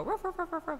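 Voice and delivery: high playful imitated barking; mock yappy woofs; dog barks. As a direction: bark like a little dog answering. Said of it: "and it would go".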